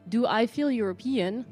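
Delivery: usual speaking voice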